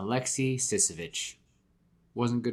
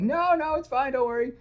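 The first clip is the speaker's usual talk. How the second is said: high, squeaky, reassuring voice; squeaky Peter; 7 dB louder than usual. Retold or imitated. Peter squeaking reassurance to Gwen on the phone. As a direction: chirp it like a guilty boyfriend.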